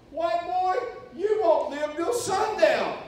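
high, loud, slow taunt; mocking guard voice. Guard taunting the American preacher, high mocking voice. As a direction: taunt the gringo with a grin. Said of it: "He's going".

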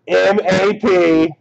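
ordinary delivery

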